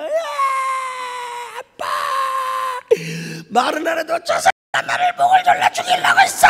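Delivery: put-on loud, high child's wail; crying child. Little son cries to his father about the attack, loud wailing child. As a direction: sob it out as the terrified little son.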